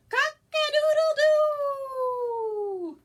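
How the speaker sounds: loud, high, slow rooster crow. Pretend. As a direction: crow like a rooster waking the farm. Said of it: "Wake up and shout".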